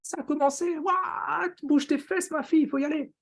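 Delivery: high, hyped-up mock exclamation; excited viewers. Coffee drinkers spotting the live has started, mock excited. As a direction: squeal it like a thrilled fan rushing to join. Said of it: "ils se disent".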